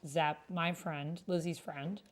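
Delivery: usual speaking voice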